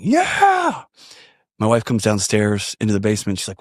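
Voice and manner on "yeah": high-pitched mock victory cry; re-enacted whoop of celebration; cheering yell. Man cheering in his basement at good news, high yell. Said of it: "I start yelling".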